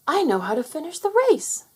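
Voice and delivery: high, eager declaration; proud of the answer; announcing. Acted. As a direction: prince announcing his solution brightly. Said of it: "Henry announced".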